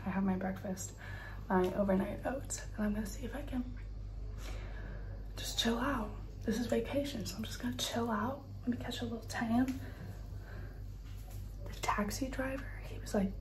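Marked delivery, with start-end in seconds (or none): 2.9-12.3 whisper. Real, not acted. Whispering plans.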